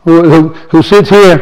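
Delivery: ordinary talk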